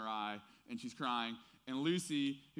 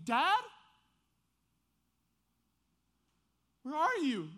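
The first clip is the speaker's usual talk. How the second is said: high, loud pleading cry; a son calling for his father in anguish; crying out for a missing father; 13 semitones higher, 10 dB louder than usual. Retold or imitated. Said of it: "he might as well be saying".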